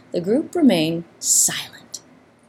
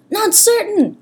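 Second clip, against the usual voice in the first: calling back; 14 semitones higher, slower than usual. A narrator's reading. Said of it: "Hezekiah called back".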